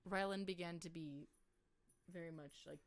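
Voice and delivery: usual speaking voice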